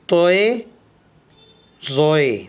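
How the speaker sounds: توئے and زوئے said with an o glide, not تا and زا; letter names with oe. Shown as a demonstration. Teacher teaching students توئے زوئے with the oe sound. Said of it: "تو یہ پڑھے جائیں گے".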